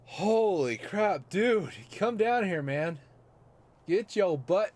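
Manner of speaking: high, loud chase; excited shouting. Real, not acted. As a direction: yell at the moth to come down. Chasing the moth.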